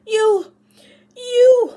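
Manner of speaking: put-on a boy screaming at his friend over a broken bow; furious shout. A narrator's reading. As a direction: choke on the words, too angry to finish. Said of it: "shouted David furiously".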